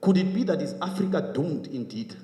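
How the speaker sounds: high-pitched, urgent public speech; rousing speech with insistent rhetorical questions; impassioned oratory. Real, not acted. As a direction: press each rhetorical question on the audience.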